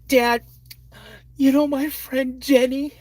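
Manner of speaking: put-on high, small girl's voice; little girl voice. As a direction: play the second-grader about to complain. Retold daughter speaking.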